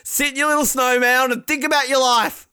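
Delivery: high-pitched comic tirade; mock yelling. Host berating the skier in her snow mound, mock yelling. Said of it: "he can yell again".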